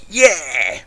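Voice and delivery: high, loud happy yell. A narrator's reading. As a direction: cheer it loudly. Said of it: "she yelled".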